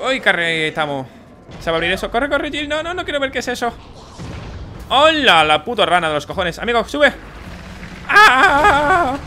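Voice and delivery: panicked high shouting; yelling in fright while fleeing; being chased. Real, not acted. Monster chasing the character. (gritos de pánico huyendo)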